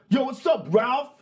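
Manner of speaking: high, loud shouted greeting; yelling across room. Acted. Party guest yells to someone across the room, loud shout. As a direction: call out loudly to someone far off. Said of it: "someone yells across the room".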